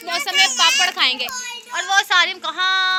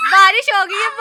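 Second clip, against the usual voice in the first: excited shout; 5 semitones higher, 7 dB louder than usual. Real, not acted. Rain suddenly starts pouring.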